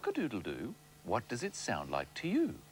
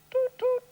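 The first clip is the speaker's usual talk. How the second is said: high louder toot toot; train whistle; 6 dB louder than usual. Retold or imitated.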